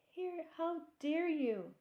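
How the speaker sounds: high, loud, slow outrage; angry shout. A narrator's reading. Soaked Miss Alma scolding the hosing children, shouting angrily. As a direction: bark it out, offended. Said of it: "she shouted".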